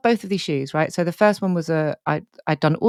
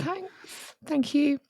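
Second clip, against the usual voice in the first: high, much softer, voice close to breaking; tearful thanks; 10 semitones higher, 5 dB quieter, much slower than usual. Real, not acted. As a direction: choke out the thanks through tears. Thanking friend through tears.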